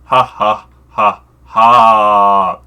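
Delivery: loud, spaced-out fake cackle; hammed-up stage laugh, last "ha" drawn out; badly faked villainous laugh; noble cackle. A narrator's reading. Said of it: "Nobly cackled".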